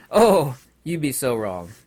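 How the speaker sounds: ordinary talk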